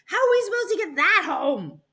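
high, loud, exasperated question; mom's dismayed cry. Acted. Mom faced with taking a dinosaur home, crying out. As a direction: cry out in disbelief as a put-out mother. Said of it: "cried my mom".

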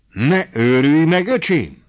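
loud, slow, stunned shout; the old uncle shouts at his nephew in shock, loud and slow; astonished outcry. A narrator's reading. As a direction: cry out in disbelief. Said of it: "kiáltott fel elképedve".

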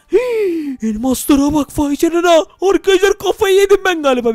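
high, loud excited gasp; thrilled gasp. Real, not acted. Player spots diamonds and squeals in excitement. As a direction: squeal with delight.

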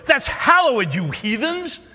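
high, loud mock outrage; outraged fan. Fan scolding the contestants online, loud mock outrage. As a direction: scold them like an indignant fan. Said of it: "Jeopardy fans were outraged".

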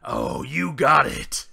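usual speaking voice

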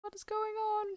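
high, baffled question; bewildered. Real, not acted. Player baffled by the ghost, high and stunned. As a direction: ask in stunned disbelief.

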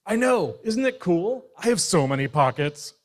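proud boast. Acted. Bear shows off his pockets, boastful and loud. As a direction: puff up with pride.

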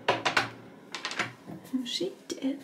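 slow, ominous whisper. Pretend. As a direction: breathe it out like a suspense line. Ominous aside about the room.

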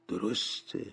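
low, soft murmur; small, muted agreement; under the breath. Acted. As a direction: murmur it quietly to yourself. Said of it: "اسکروچ زیر لب گفت".

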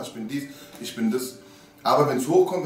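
ordinary talk, nothing marked

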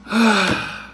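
a long audible sigh of relief. Shown as a demonstration. Host showing viewers a sigh of relief. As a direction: let the breath out slowly, relieved. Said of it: "like, sigh of relief".

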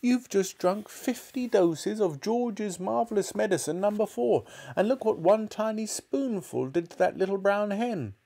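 high-pitched panicked wail. A narrator's reading. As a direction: lament it in horror, voice held high. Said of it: "wailed Missus Cranky".

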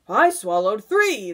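loud, punched boast; centipede's cry. A narrator's reading. Centipede outdoing the earthworm, loud boastful cry. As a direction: cry out to top the others. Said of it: "the centipede cried".

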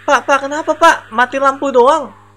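urgently calling to the man during the blackout. Real, not acted. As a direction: call to the man in the dark.

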